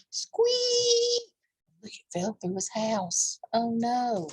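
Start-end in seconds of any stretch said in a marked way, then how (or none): 0.1-1.3 high squeak effect; squeaky sound effect. Acted. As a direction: squeak it like a toy.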